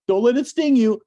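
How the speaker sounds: high, loud alarmed plea, "Don't let it sting you"; panicked warning. Retold or imitated. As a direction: imitate a worried wife calling out. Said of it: "she's freaking out".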